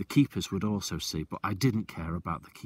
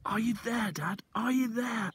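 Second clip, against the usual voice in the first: shouting. Acted. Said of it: "I shouted".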